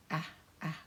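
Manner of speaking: "ah, ah" as the short a of apple; short a sound. Shown as a demonstration. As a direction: say "ah, ah" short and clipped. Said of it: "It says A and ah".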